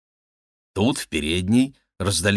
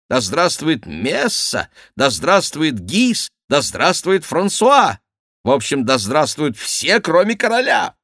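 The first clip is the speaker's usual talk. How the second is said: indignant courtier; 8 semitones higher, 4 dB louder than usual. Acted. Favourite reports the mob's cheers to the king, high and indignant. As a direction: hammer out the mob's slogans with aggrieved force.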